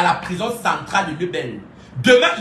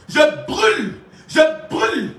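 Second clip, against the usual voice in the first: mock spell shout; 6 semitones higher than usual. Hurling a joking fire curse at Ibana, shouted. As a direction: cast the burning spell with a yell.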